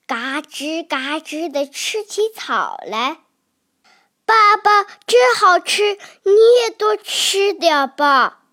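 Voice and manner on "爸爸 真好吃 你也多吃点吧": put-on high, bright little child calling dad; a small dinosaur happily offering food to its papa; sweet baby voice. Baby dinosaur urging its papa to eat more, in a high child's voice.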